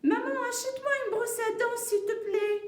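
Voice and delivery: put-on high, sustained pleading child voice; child asking mum. Jade asks mum for a toothbrush, high child voice. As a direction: play a little kid begging at high pitch. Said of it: "Qu'est-ce qu'il veut Jade?".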